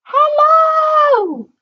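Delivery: very high, squeaky little shout; tiny character shouting. A narrator's reading. Mister Small trying to be noticed from below, tiny high shout. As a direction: yell it in a tiny, very high voice, drawn out. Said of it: "shouted at the top of his voice".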